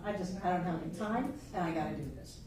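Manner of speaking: a hint of a tired martyr sighing through chores; mock weary grumble. Teacher mimicking a student who lives by obligation, mild weary grumble.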